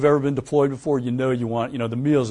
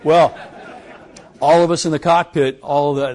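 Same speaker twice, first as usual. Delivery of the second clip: ordinary talk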